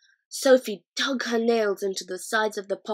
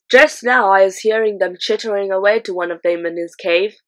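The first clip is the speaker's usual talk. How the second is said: accusing giant; 10 dB louder than usual. A narrator's reading. Blood Bottler accusing BFG.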